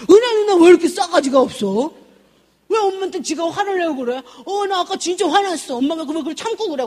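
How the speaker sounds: high-pitched, worked-up complaint; indignant son. Retold or imitated. Son griping to mom about his sister, indignant. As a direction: protest like an outraged kid brother.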